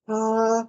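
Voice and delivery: high, steady sung "ah"; wind's held note. Acted. Game master imitating the note on the breath of wind, high held tone. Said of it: "on this wind is a note".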